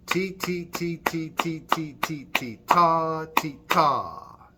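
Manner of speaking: chanted ti and ta beats; teacher demonstrating a rhythm for children; rhythm demo. Shown as a demonstration. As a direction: chant a rhythm for them to copy. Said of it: "I want you to listen".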